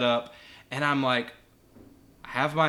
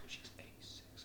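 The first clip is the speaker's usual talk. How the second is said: barely audible whispered aside; 28 dB quieter than usual. Retold or imitated. Teacher explaining to student next to the patient, whispered. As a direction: breathe it so the old lady can't hear.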